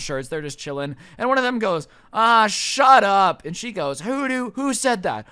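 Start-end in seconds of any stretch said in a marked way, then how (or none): 2.1-3.5 loud, long-drawn heckle; heckling. Retold or imitated. Construction guy at next register heckles the ranting customer, loud and drawn out. 4.0-5.3 high, indignant repeated demand; outraged demand. Retold or imitated. Irate customer demands to know who heckled her, high and indignant.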